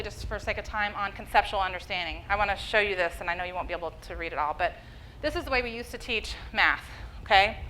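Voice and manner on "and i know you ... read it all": normal talking voice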